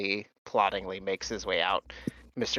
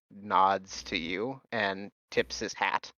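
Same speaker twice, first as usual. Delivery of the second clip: usual speaking voice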